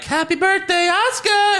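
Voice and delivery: very high squealed birthday greeting; hammed-up high chirp; mock cheery greeting. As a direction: imitate an over-excited well-wisher. Retold birthday greeting of wrestlers.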